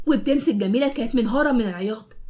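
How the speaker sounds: ordinary delivery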